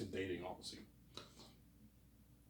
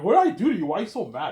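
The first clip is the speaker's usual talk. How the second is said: higher, louder mock protest; exasperated retort; 8 semitones higher, 12 dB louder, faster than usual. [name] pushing back at his yelling manager, loud and exasperated. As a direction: throw it back at the angry boss. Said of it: "He was pissed off".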